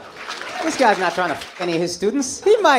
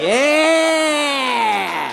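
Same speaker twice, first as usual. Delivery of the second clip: cheer; 5 semitones higher, 3 dB louder than usual. Real, not acted. Cheering the ladies.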